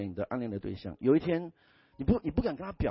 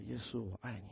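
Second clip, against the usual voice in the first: tender prayer; 11 dB quieter than usual. Retold or imitated.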